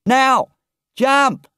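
high, loud shouted command; calling out. Acted. Engine driver calling to Toad to leap, shouted. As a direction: yell the signal over the engine. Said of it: "he heard the driver call out".

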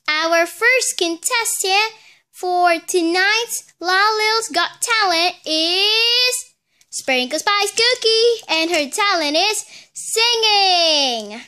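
slow, loud host welcome; little host opening the talent show. Acted. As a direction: announce it like a talent show emcee. Host introduces contestant.

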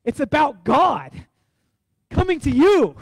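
loud, slow, climbing preacher's cry; preaching at full force. Acted. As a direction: lift it up to the congregation.

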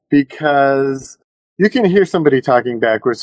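usual speaking voice

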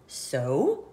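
low, odd snake voice. Acted. Snake answers the friends, low and blunt. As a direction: shrug it off low. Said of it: "says the snake".

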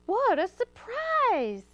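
high, slow, drawn-out exclamation; exclaiming. A narrator's reading. Hamlet stalls the fox for time, exclaiming. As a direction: stretch it out in exaggerated surprise. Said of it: "exclaimed Hamlet".

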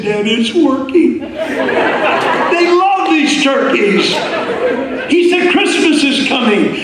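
bubbling over with good news on the phone; excited son. Retold or imitated. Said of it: "Now he's excited".

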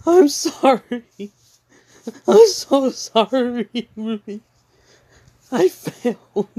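high, breaking, pleading sorry; tearful apology. Retold or imitated. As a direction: beg forgiveness on the verge of tears. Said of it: "And John's like".

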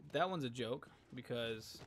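ordinary talk

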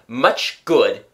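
loud, bitter grumble. A narrator's reading. Unappreciated father complains to family, bitter. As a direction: say it with sour self-pity. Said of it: "he said bitterly".

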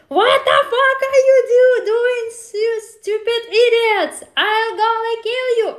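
high, loud screaming threat; shrieking mock rage at stupid coworkers; furious yell. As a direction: scream at them like a madwoman with scissors. Mock attacking coworkers with scissors.

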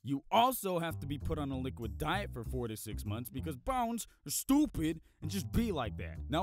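ordinary talk, nothing marked

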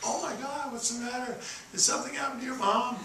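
high-pitched worried wife voice; mock anxious falsetto; imitated alarmed wife. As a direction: put on a high, fretting voice for the wife. Voices alarmed wife returning to him.

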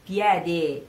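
ordinary talk

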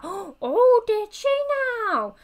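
loud, high scandalised gasp; mock astonishment. Villager hearing of the ghost sighting, hammed-up astonishment. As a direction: react with exaggerated shock to gossip. Second villager reacts to gossip.

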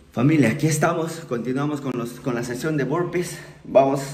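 loud, driving talk over the exercise; rally the family while doing burpees; loud motivational workout speech; workout pep talk. Real, not acted. Talking loudly mid-workout session.

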